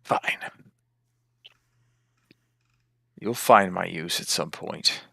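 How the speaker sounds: lightly hissed creature voice; a hint of a sinister totem, spaced out; hissing totem. Totem grudgingly answering the orc, lightly hissing. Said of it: "hissing kind of a chuckle in your head".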